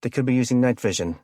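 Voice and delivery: yelling back. A narrator's reading. Kenny yells back to Taylor while driving off, yelling. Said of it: "Kenny yelled back".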